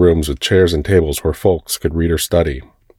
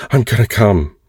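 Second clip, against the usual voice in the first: panting; 5 semitones higher than usual. Acted. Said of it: "I panted".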